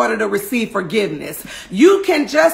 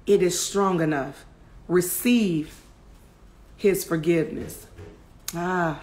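minister gently to the listener; hushed altar call; 5 semitones lower, 5 dB quieter than usual. Real, not acted. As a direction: pastor softly assures sinners of forgiveness. Quiet altar-call close.

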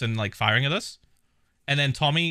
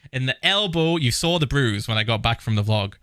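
ordinary delivery

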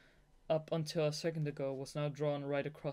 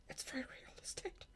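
whispered joke through laughter; giggly whisper; 10 semitones higher, 10 dB quieter than usual. Real, not acted. Reader whispering a joke about the book, giggling. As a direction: breathe the joke under your breath, amused.

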